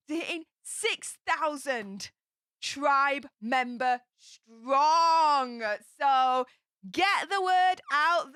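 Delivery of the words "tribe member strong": very slow, stretched hype words; slow proud rally cry; drawn out. Real, not acted. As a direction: savor each word of the milestone. Hyping subscriber milestone.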